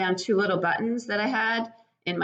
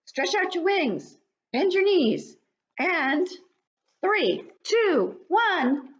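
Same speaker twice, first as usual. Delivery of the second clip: much higher, louder cheering bug voice; cheering parent bug; 13 semitones higher, 3 dB louder, slower than usual. Acted. Parent bug cheers the baby flying, high and excited. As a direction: cheer the little one through its first flight.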